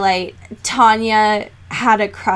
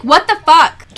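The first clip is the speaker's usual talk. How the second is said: exasperated outburst, higher and much louder; pissed off; 7 semitones higher, 8 dB louder than usual. Real, not acted. Podcaster venting to co-host about the book, pissed off. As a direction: burst out in indignant disbelief. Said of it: "I got pissed really quick".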